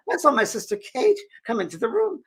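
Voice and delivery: high Scottish retelling; Scottish accent. Retold or imitated. Scottish lady recalls seeing her sister, high Scottish accent. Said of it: "I'm trying to my Scottish accent".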